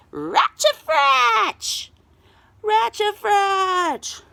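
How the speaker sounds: high, loud, slow chanted roar; roaring crowd. A narrator's reading. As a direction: bellow it like a crowd. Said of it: "they roared".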